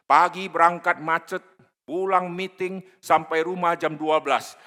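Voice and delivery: loud, high, driving sermon; fervent preaching. Real, not acted. Preacher exhorts the congregation, loud fervent preaching. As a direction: preach it to the rafters.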